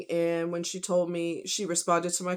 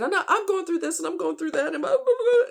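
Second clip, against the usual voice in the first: much higher, louder voice, sobbing and laughing at once; crying through laughter; 12 semitones higher, 6 dB louder, faster than usual. Real, not acted. Recalling what she told the kind stranger, crying and laughing. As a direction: cry and laugh as the words spill out.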